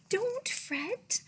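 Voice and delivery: lightly voiced, high little mouse; a hint of a squeaky mouse. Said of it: "Mouse squeaks".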